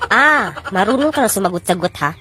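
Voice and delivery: low, scolding mother's voice; a mother telling off her kid. Acted. Mother scolds talking-back child. (mababang boses ng nanay na nagagalit)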